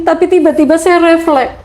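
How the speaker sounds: ordinary talk, nothing marked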